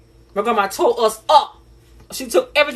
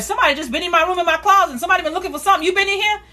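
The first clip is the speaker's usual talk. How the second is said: higher, accusing and probing; suspicious grandma; 9 semitones higher, faster than usual. Retold or imitated. Grandma questioning the kids about her closet, higher and accusing. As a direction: grill the kid about the closet. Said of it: "She's like".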